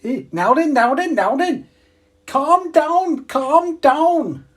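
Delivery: high, loud mock Scouse shout; Scouse accent. Shown as a demonstration. Streamer asked to do a Scouse accent, loud high Scouse impression. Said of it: "Do a Scouse".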